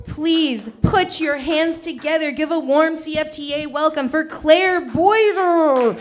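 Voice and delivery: loud, high, drawn-out introduction; stage announcer. Real, not acted. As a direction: build to a big shouted name for the crowd. Host calls the next act on stage.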